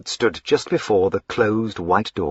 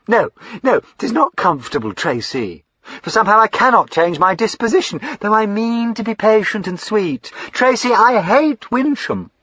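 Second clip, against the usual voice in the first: higher, excitable fine lady; eager young lady Lavinia; 6 dB louder than usual. Acted. Lavinia gushing to her brother, high and eager. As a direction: gush up high like a spoilt sister.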